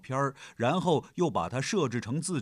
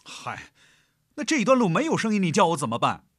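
louder, fast, irritated retort; flaring temper; 8 semitones higher, 6 dB louder, faster than usual. Acted. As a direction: snap back in anger. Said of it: "她的火气上来了".

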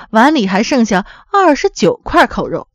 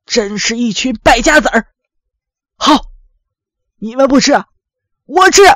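put-on indignant old father, halting and scornful; very angry. A narrator's reading. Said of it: "十分生气". (老父亲气愤地斥责，最后赌气)

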